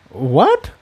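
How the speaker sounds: sharp surprised exclamation; exclaiming. Acted. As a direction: blurt it out in surprise. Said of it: "Incendia exclaimed".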